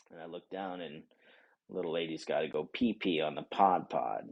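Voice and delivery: ordinary delivery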